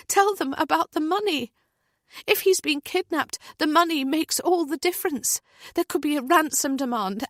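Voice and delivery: high, pressing insistent voice; desperate mother. A narrator's reading. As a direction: argue hard for help. Heather argues for senior police.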